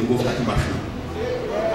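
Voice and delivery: soft, low, determined self-talk; man deciding quietly to rise; quiet resolve. Retold or imitated. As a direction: say it low to yourself. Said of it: "disse com ele mesmo".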